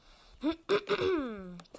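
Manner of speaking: long, loud throat clear. Real, not acted. (dres prelung al vocii)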